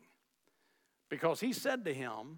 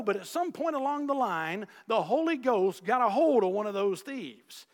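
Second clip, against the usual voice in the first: usual speaking voice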